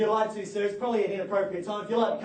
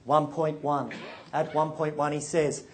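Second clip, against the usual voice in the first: rhythmic auction bid calling; auctioneer call; 5 semitones lower than usual. Real, not acted.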